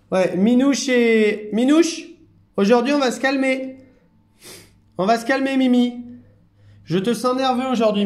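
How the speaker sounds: high singsong telling-off, stretched names; high-pitched pet admonishing. Real, not acted. Carer telling nervous Minouche to calm down, high singsong chiding.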